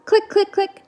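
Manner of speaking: high clicking noises; clicking sound. Acted. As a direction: click it out like a button. Button clicking sound effect.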